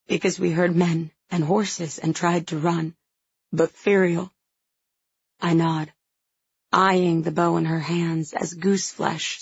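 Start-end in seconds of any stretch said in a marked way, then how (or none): none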